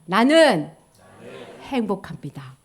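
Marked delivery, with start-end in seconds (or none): none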